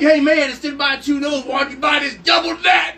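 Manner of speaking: loud, high mock sales pitch; pushy salesman shout. Counter guy pitching a customer, pushy shout. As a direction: sell it at full volume, laughter creeping in. Acts out an over-eager supply salesman.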